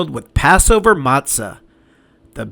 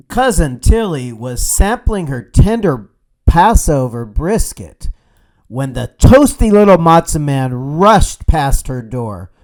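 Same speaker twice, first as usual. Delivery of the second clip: ordinary delivery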